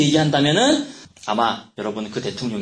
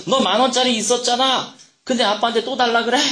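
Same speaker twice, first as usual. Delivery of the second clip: higher and louder, exasperated scolding; irritated father; 9 semitones higher, 4 dB louder than usual. A narrator's reading. 짜증 난 아빠가 아들을 나무라는 목소리. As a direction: snap at the boy who already has money. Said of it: "아빠가 또 짜증이 나기 시작을 했어요".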